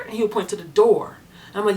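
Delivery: ordinary delivery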